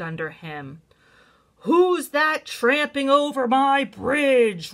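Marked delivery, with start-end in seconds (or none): 1.6-4.7 loud, high, slow roar; roaring troll. A narrator's reading. Troll challenging the biggest goat, full loud roar. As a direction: bellow it out, stretching each word. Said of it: "roared the troll".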